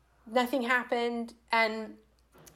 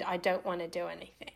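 soft, lowered voice; quiet near-whisper; 4 semitones lower, 7 dB quieter, faster than usual. Retold or imitated. Naked woman telling a man her boundary, quiet and hushed. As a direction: say it small and hushed. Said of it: "quietly whispered".

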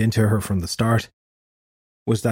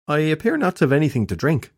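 coy; 6 semitones higher than usual. Acted. Shelley's coy hint.

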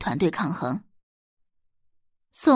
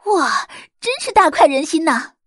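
higher, louder delighted shout; gleeful cheer; 7 semitones higher, 6 dB louder than usual. A narrator's reading. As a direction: crow with glee. Forum users cheer the hack.

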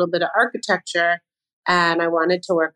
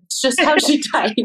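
amused higher voice breaking into laughter; laughing; 7 semitones higher than usual. Retold or imitated. Boss quoting staff's warning about her texts, laughing. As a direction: laugh through the retold reassurance.

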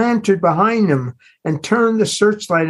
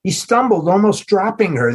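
ordinary delivery, nothing marked

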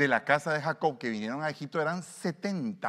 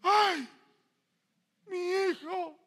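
very high, wailing mother's cry; mock llorona wail; 5 dB louder, much slower than usual. Preacher imitates a mother crying for her son. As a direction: wail like the weeping woman. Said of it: "empieza con el síndrome de la llorona".

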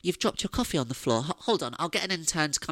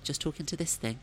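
ordinary talk, nothing marked

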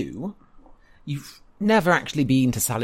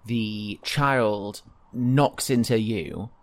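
usual speaking voice

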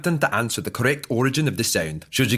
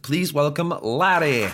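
game show host; 3 semitones higher than usual. Acted. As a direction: play it like a cheesy quiz host.

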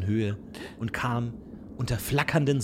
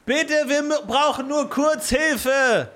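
much higher, pleading sailor; 14 semitones higher, 4 dB louder than usual. Acted. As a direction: beg for help in a high voice.

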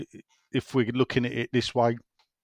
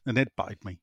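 normal talking voice

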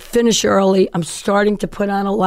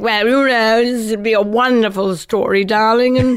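amused dad. Retold or imitated. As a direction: play a grandly reassuring father. Acts out amused dad's reply.

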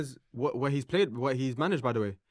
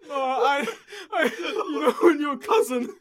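very high, squeaky, struggling to talk; slow words squeezed out through real laughter; helpless laughter; 12 semitones higher than usual. Real, not acted. Explaining a mad scenario to friends, helpless laughter. Trying to talk while cracking up.

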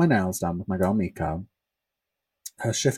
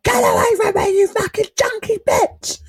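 high, loud enraged screech; furious, high-pitched shrieking; angry scream; 7 dB louder than usual. Retold or imitated. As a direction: scream abuse at the attacker in anger. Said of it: "I'm screaming out of anger".

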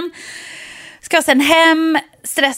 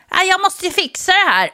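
garbage man; 8 semitones higher than usual. Retold or imitated. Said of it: "Gubben kliver ut".

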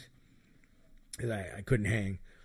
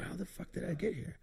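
confused voice; 7 semitones higher, 8 dB quieter than usual. Retold or imitated. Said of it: "And I said".

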